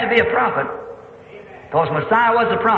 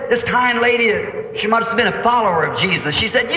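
ordinary talk

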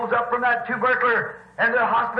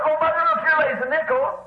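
excited discovery; 6 semitones higher than usual. Retold or imitated. Daughter finds money, retold.

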